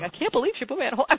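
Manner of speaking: replaying her complaint. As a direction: fire it off like the muttered gripe. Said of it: "with me going".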